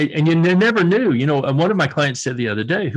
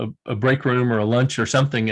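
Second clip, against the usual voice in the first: ordinary delivery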